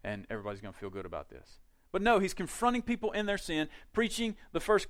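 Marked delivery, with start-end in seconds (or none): 0.0-1.6 hushed, softer, cozy coaxing voice; soft-soap the crowd like a coddling host; mock coddling. Preacher mocking a soft sermon, in a soft coddling voice. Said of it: "It's not like coddling".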